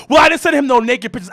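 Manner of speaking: high, fast girlfriend imitation. Imitating girlfriend excusing herself, high and fast. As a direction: whine it fast. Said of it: "she's like".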